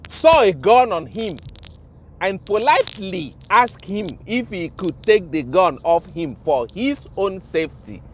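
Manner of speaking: ordinary talk, nothing marked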